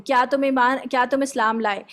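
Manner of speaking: ordinary talk, nothing marked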